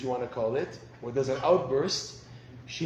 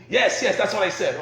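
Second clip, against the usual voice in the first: a higher, louder, eager yes yes; eager wife; 6 semitones higher, 8 dB louder than usual. Retold or imitated. Wife confirms husband got it right, higher and louder. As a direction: confirm it gladly.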